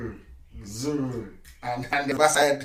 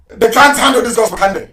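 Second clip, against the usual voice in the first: loud, raised-pitch taunt; fiery preaching; 7 semitones higher, 11 dB louder than usual. Real, not acted. Broadcaster taunting those who can't handle the gospel, loud and defiant. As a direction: preach it defiantly at full force. Broadcaster exulting over opponents.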